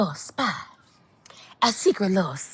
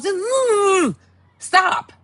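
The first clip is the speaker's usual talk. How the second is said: high exasperated stop; 10 semitones higher, much slower than usual. Real, not acted. Fed up with loud men.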